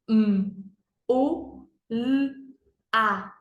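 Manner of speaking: M, U, L, A as "mmm, u, lll, a"; letter sounds. Shown as a demonstration. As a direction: sound out each letter, consonants held. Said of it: "Vamos fazer o sonzinho". (sonzinho de cada letra: "mmm, u, lll, a")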